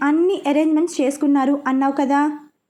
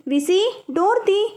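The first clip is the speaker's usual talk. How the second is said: calls through the door, pitched high; mother calling from outside the locked door; 6 semitones higher, slower than usual. Acted. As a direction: shout for her to open the door. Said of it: "అంటూ అరుస్తోంది".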